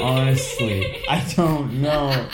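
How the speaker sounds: slow, laughing through the words. Real, not acted. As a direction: giggle helplessly as you say it. Laughing while stuck.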